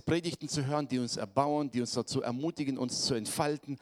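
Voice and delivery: usual speaking voice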